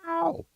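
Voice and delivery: pained yelp. Real, not acted. Reacts to audio blast.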